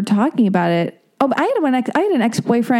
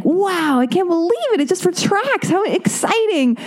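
higher, sarcastic wide-eyed wonder, the last words slow; mock amazement; 8 semitones higher than usual. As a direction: play fake fascination, pitched up high. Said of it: "and I was like".

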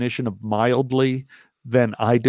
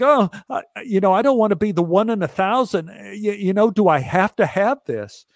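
nervous pleading; 6 semitones higher than usual. Retold or imitated. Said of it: "I'm going like".

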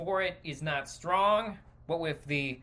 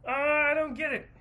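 clueless Hollywood exec; 9 semitones higher, faster than usual. Retold or imitated. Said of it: "they're just like".

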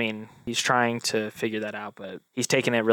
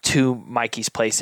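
ordinary talk

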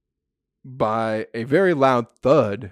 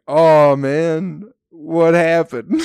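louder, higher, drawn-out question; hammed-up groggy astonishment; stoned bewilderment; 6 semitones higher, 8 dB louder, much slower than usual. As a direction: play a dazed stoner stumbling onto a scene. Acts himself stoned, popping out.

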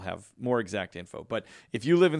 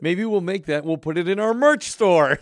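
excited; 7 semitones higher, 9 dB louder than usual. Real, not acted. Excited merch joke.

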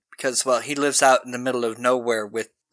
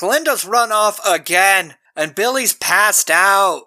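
panicked report; 6 semitones higher, 4 dB louder, slower than usual. Retold or imitated. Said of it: "comes running up".

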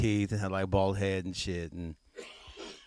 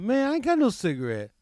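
mock refusal; 12 semitones higher, 3 dB louder, faster than usual. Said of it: "I'm like".

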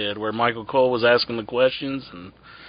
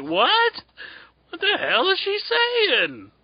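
much higher, slow confused outcry; baffled squeak; slower than usual. Retold or imitated. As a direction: squeal out total bewilderment. Baffled listener, very high.

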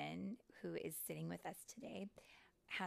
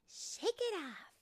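high, slow, drawn-out cheering-on; bright encouragement; 13 semitones higher, 8 dB louder, much slower than usual. Acted. Water fairy encouraging Tinker Bell after mishap, upbeat. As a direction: cheer your friend on, pitched way up.

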